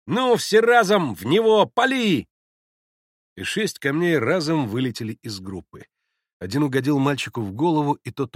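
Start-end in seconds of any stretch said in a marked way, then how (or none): 0.1-2.3 high, loud call to fire; rally the gang to throw; shouted command. A narrator's reading. Мальчишки командуют залп камнями. (громкая мальчишеская команда)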